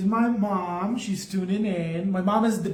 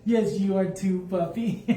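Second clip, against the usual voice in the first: laughing pet talk. Real, not acted. Painter laughs and coos to her own dog, giggly baby talk. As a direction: laugh while cooing at your pet. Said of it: "when I started talking like that".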